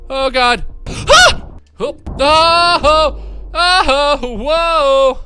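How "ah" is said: high shrill shriek; panicked scream. Real, not acted. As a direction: scream in sudden fright.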